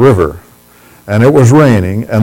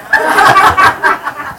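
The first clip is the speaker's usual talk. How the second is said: quick high breathy laugh; short unvoiced chuckle mid-sentence; breathy giggle. Real, not acted. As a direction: let a little airy laugh escape. Laughing at his own joke.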